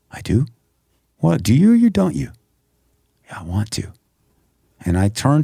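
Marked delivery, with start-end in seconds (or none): 0.1-2.3 pitched up, disbelieving question; incredulous retort. Retold or imitated. Arguing with God, incredulous. 3.3-3.9 whispered, grudging yes; hushed reply. Retold or imitated. Answering God, a reluctant whisper.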